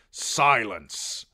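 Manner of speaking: king's loud order; sharp command. A narrator's reading. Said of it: "Stannis commanded".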